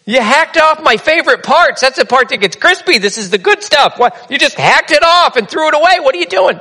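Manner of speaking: high, indignant complaint over the ham ends; outraged husband. Retold or imitated. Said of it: "He goes".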